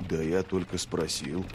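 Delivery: low, soft, defensive murmur; shrugging reply. A narrator's reading. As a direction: back down quietly, with a shrug in the voice. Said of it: "пожал плечами Логин". (тихо, низко, словно пожимая плечами)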